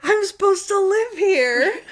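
high, slow wail with laughter; hammed-up high-pitched distress; imitated freak-out. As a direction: whine it in mock despair, half laughing. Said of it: "and Monk is like".

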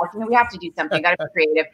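tossed off in a hurry, half-joking; rushed mock enthusiasm. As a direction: rattle it off very fast, a light mock quote. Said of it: "We were just like".

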